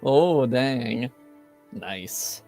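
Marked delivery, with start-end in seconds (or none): none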